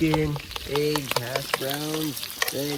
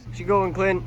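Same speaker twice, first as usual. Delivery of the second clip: ordinary delivery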